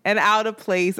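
ordinary delivery

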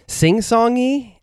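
high, slow sing-song lilt; sing-songy. Shown as a demonstration. Describing Argentinian Spanish, sung out high and slow. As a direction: stretch it out in a lilting Italian melody. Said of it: "this Italian flavor that's".

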